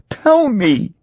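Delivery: very high, pleading wife's voice; insistent wife. A narrator's reading. Tearful wife demands the truth.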